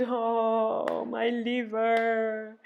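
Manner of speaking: long, high, voiced sigh. A narrator's reading. Ereshkigal sighing over her liver, long high wail. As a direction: stretch the words into a wailing sigh. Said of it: "She sighed".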